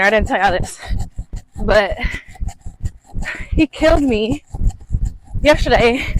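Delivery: breathless while jogging; out of breath. Real, not acted. Talking while running.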